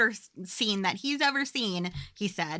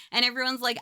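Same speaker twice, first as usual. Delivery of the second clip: ordinary talk, nothing marked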